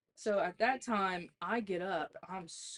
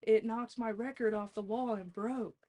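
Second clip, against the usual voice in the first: excited brother; 6 semitones higher than usual. Retold or imitated. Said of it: "He's like".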